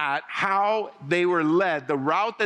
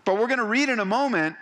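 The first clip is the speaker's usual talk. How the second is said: usual speaking voice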